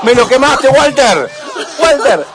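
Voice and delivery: agudo y fuerte, entre risas; grítalo encantado, riendo; grito eufórico. Real, not acted. Reacción eufórica al relato. (grito eufórico entre risas)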